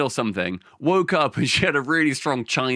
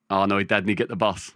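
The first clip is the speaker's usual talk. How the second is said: lower, offhand Glaswegian; Glaswegian accent; 5 semitones lower than usual. Retold or imitated. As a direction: talk like a Glaswegian dad. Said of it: "his dad was very Glaswegian".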